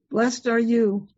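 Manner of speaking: ordinary talk, nothing marked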